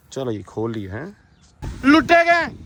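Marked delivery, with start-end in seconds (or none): 1.8-2.5 dramatic dismay. Real, not acted. Covered in mud by horse.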